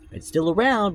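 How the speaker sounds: emphatic. Real, not acted. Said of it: "I'm reiterating this".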